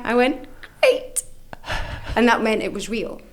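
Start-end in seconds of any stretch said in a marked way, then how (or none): 0.8-1.2 much higher, thrilled; delighted squeak. Retold or imitated. Thanking the charity woman, high delighted squeak. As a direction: squeal it with glee. Reacting to the place.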